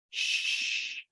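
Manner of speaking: long, soft shushing; soft imitated maternal shush; hushing shh. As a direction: hush a child with a drawn-out shh. Imitating mother hushing the child.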